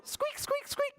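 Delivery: tiny high squeak squeak; mouse squeaks. Shown as a demonstration. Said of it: "can you squeak like a mouse".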